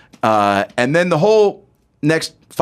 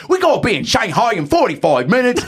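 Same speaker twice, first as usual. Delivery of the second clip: put-on high-pitched caricature British accent; hammed-up British voice; little British man; 9 semitones higher than usual. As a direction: play a tiny British manager, pitched high. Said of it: "his manager's like".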